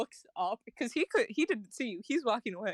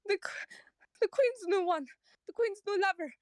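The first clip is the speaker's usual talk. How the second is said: startled farmer; 9 semitones higher than usual. Acted. Startled farmer asks Rain about God's voice.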